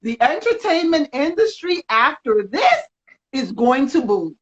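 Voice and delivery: ordinary talk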